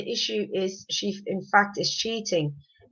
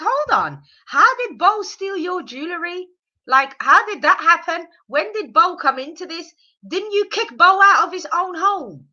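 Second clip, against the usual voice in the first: higher, louder mock-interrogation; incredulous challenge; 6 semitones higher, 5 dB louder than usual. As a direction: fire off questions in disbelief. Acts out disbelieving reaction to claim.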